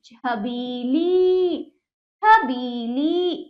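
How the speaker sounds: Chhabili very slow, both ई held long; drawn out, joined. Shown as a demonstration. Teacher practises reading whole words, drawn out. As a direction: stretch बी and ली in Chhabili. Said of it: "जोड़कर पढ़ने का प्रयास".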